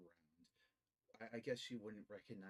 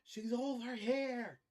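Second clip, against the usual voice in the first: slow, much higher and louder yell; 13 semitones higher, 13 dB louder, slower than usual. Acted. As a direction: call the others over at full shout. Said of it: "she yells out".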